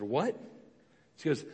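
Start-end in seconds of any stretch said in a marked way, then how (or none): 0.0-0.4 baffled, rising "what?"; acted-out bewilderment; mock disbelief. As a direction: query it softly as if you misheard. Retells his baffled reaction to woman.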